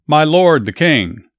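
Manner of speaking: calling out loud and high; yelled. A narrator's reading. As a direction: shout after the departing king. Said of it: "David came out and yelled".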